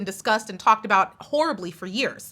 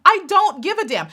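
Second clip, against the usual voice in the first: high emphatic defiance; 7 semitones higher, 4 dB louder than usual. Real, not acted. Third take higher.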